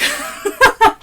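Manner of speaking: high-pitched giggle. Real, not acted. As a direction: giggle at yourself. Laughs at herself.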